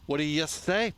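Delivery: light mock Minnesota accent; a hint of upper Midwest lilt. Host teasing viewers in a light Minnesota accent. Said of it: "when I got up into Minnesota".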